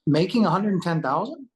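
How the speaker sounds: high, loud disbelieving question; incredulous. Retold or imitated. Reacting to the job offer, incredulous. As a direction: echo the figure in astonishment.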